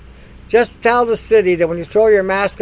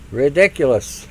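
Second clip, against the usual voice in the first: ordinary delivery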